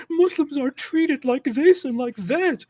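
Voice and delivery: high, slow mocking whine. Mocking Islamists' complaints in a high slow whine. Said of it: "start crying about persecution".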